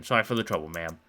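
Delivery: plain reading voice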